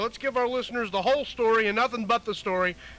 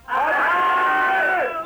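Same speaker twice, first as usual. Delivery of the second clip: a crowd yelling hi; group shout; 7 semitones higher, 7 dB louder than usual. Real, not acted. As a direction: everyone shout hello at once.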